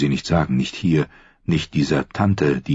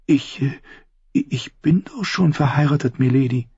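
put-on higher, halting young voice; timid girl; 9 semitones higher than usual. A narrator's reading. Sansa blushing as she answers her aunt, hesitant. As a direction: stammer it out, embarrassed.

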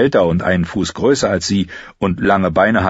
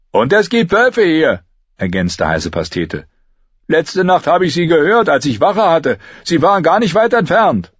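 uneasy Heiße Pastete; 10 semitones higher, 4 dB louder than usual. A narrator's reading. Heiße Pastete warns about wolves, uneasy. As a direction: warn of wolves nervously.

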